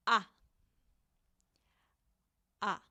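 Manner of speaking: one short pure A sound; Spanish A demo. Shown as a demonstration. Teacher shows learners one short Spanish A. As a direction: say A short, no glide. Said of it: "it will be like this".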